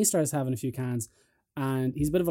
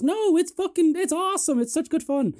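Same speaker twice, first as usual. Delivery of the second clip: high put-on girly voice; mock her, high and bright-eyed; a much higher mocking voice for her; imitating her; 3 dB louder than usual. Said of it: "And it goes".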